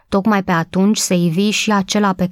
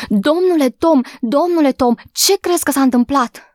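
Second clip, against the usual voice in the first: breathless excited call; 7 semitones higher than usual. A narrator's reading. Maid runs to the old gardener with news, excited and breathless. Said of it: "strigă ea, gâfâind".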